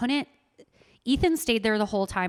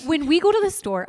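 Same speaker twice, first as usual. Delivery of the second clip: raised, loud Minnesotan vowels; Minnesotan accent; 8 semitones higher, 7 dB louder than usual. Real, not acted. Mother talking about her own mom, Minnesotan accent slipping out. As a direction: let the Minnesotan accent slip out loudly. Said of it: "I sound so Minnesotan".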